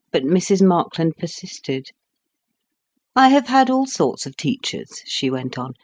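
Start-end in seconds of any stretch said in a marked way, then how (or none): 3.1-5.0 a hint of a chatty lady, pitched higher; lightly voiced society lady. A narrator's reading. Hostess recommending a drawing master, lightly voiced chatter. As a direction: gush lightly about a favourite teacher. Mrs Markland quoted in story.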